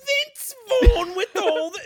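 high, loud comic British outburst. Acted. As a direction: launch in with a hammed-up British accent. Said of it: "Can we do my British voice again?".

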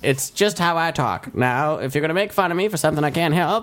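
put-on high old-timey driver, a little hurt; old-timey shuttle voice. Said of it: "old-timey shuttle voice".

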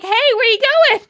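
very high, loud cartoon voice; squeaky mock call. Host imitating Satan calling down the hole, squeaky and loud. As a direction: squeak it after her, bewildered. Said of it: "He's like".

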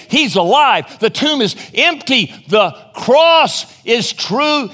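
loud, high, triumphant proclamation; emphatic exclamation. Acted. Preacher voicing God's declaration of the resurrection, emphatic exclamation. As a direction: proclaim it like an exclamation mark, the last words drawn out. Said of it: "God's exclamation mark to say".

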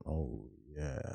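low, slow late-night radio host; sensual radio voice. Acted. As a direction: croon it low like a smooth DJ. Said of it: "this man sounds sensual as fuck".

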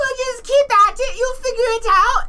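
put-on very high falsetto girlfriend voice; put-on high-pitched encouragement; girlfriend impression. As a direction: squeak it out as the girlfriend. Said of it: "his girlfriend is like".

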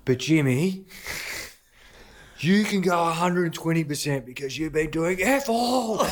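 high mock jab at Jimmy; teasing call-out. Coach mocks a slacking player in front of all, teasing. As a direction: needle him with a high sarcastic twist.

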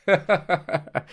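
soft mimicked chuckle; imitated laughing. Bartender and customer sharing a private joke, imitated soft laughter. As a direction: imitate two people laughing among themselves, softly. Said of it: "they're just laughing amongst themselves".

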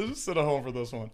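repeated through a laugh. Retold or imitated. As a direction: say it while laughing. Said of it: "So we're like".

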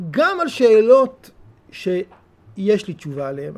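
drawn out, each word weighed; slow down to stress the point; slow deliberate teaching line; slow emphasis. Shown as a demonstration. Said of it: "slow emphatic teaching".

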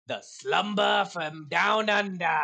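put-on slow, drawn-out Australian accent, half-shouted; Aussie accent. Podcast host announcing the segment in an Aussie accent. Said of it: "that's my Aussie accent".

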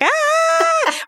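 long, high mock shriek; imitated scream. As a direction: act out her horrified scream, high-pitched. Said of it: "And she screams".

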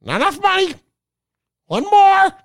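high squawky toucan voice; big beaked toucan. Retold or imitated. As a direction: squawk the words like a bird. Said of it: "first time I've ever done a Toucan impression".

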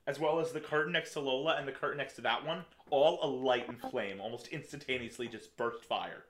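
slow dramatic scene setting; dramatic narration. Acted. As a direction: build the blaze slowly. DM narrates the fire.

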